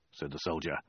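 ordinary delivery

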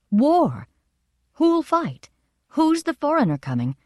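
high, eager gossiping questions; excited chatter. A narrator's reading. Townspeople spreading news of the oracle, chattering excitedly. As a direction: toss out alarmed questions, pitched high.

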